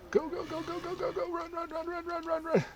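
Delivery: very high panicked chant; terrified horror victim. Real, not acted. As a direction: squeak it out frantically, fleeing. Fleeing enemies in game.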